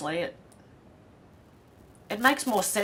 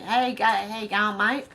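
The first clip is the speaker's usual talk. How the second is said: loud, higher, hammed-up Aussie accent; Aussie magpie; 4 semitones higher, 9 dB louder than usual. Imagining a talking magpie greeting a mate, broad Aussie accent. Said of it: "Imagine a magpie with the Aussie".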